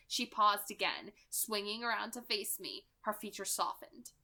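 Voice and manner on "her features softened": usual speaking voice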